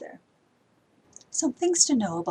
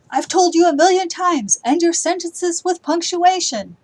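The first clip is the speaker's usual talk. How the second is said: much higher and louder, fed-up nagging; exasperated scolding; 9 semitones higher, 9 dB louder than usual. Shown as a demonstration. Teacher acts a fed-up parent scolding the class. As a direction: scold like you've said it a million times.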